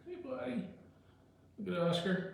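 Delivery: high, sing-song voice for the dog; pet talk voice. Real, not acted. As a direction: coo at your pet. Talking to the dog.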